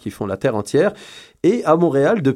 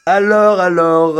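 louder and higher to restart; loud excited call; 6 semitones higher, 6 dB louder, much slower than usual. Real, not acted. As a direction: call out to grab the mic. Relance excitée à l'antenne. (relance forte et excitée)

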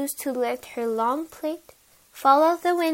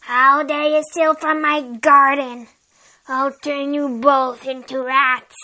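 angry witch; 6 dB louder than usual. Acted. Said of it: "She screamed".

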